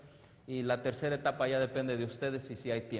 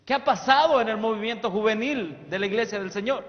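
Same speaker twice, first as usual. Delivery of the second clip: preaching; 7 semitones higher, 9 dB louder than usual. Acted. Preacher proclaiming a prophetic quote to the youth, loudly. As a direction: proclaim it to the whole church.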